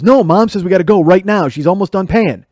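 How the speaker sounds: insistent little brother. Retold or imitated. Little brother urges them to leave.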